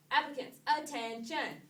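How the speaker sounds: crisp, loud call to attention; loud command. Real, not acted. Captain calls applicants to attention.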